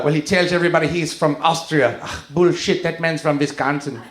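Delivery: put-on scoffing in a German accent; German mom. German mom doubting the Pope's origins, scoffing German accent. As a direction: dismiss it with a mock German accent. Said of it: "my mom is German".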